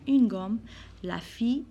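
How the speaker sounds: ordinary talk, nothing marked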